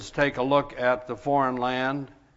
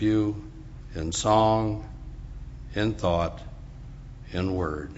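prayer; 3 semitones lower, 4 dB quieter than usual. Real, not acted. Opening worship by inviting the Spirit, solemn measured prayer. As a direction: pray phrase by phrase, low and unhurried.

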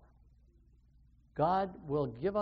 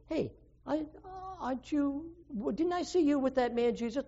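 higher, softer, quick suspicious questioning; a voiced stranger asking, lightly higher and hushed; questioning bystander; 6 semitones higher, 4 dB quieter than usual. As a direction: poke at him, half sure you saw him. Second person questions Peter.

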